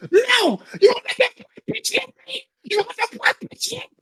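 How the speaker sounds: very high-pitched mock tantrum wail; imitated screaming fit. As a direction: act out her hysterical fit, squealing high without full volume. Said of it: "having a fucking fit".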